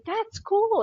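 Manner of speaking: high, breezy mock of the guy; imitating the crew guy. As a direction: toss it off as he did. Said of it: "And the guy's like".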